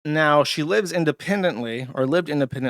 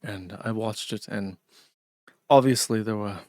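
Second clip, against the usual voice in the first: a mock Scottish YouTuber, lower and dour; slightly lower, gloomy Scottish accent, imitating a commentator; 4 semitones lower than usual. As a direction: do him in a downtrodden Scottish accent, deadly serious. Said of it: "this really dour, downtrodden way of speaking with, like, a Scottish accent".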